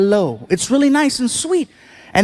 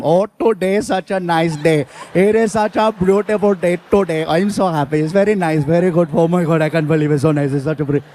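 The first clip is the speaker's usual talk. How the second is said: put-on lower, bubbly chatter in a lightly done Indian accent; cheerful Indian accent; 5 semitones lower than usual. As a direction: gush about the nice day, Indian accent. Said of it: "With Indians, it's all the time".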